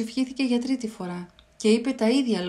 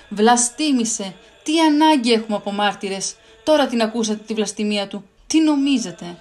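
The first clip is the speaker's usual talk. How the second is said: indignant shout; 5 dB louder than usual. Acted. Caiaphas tears his robes and shouts at the council, outraged. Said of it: "αγανακτισμένος τα ρούχα του και φώναξε".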